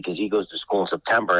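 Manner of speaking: ordinary talk, nothing marked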